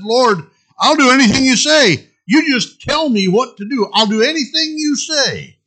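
high, loud eager pleading; eager promising. Acted. Jack interrupting the Lord, eager frantic promise. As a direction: promise anything, desperate.

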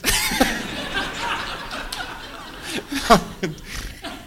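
long high airy laugh; real laugh. Real, not acted. Preacher laughs at the young man's remark, long and airy.

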